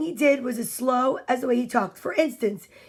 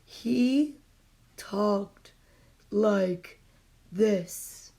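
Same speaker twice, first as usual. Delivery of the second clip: lower, drawn-out words; slow talk; 4 semitones lower, 5 dB quieter, much slower than usual. Shown as a demonstration. Teacher showing the class Mister Slow's way of talking. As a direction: pause between each word like Mister Slow. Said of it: "talked in an extraordinarily slow way".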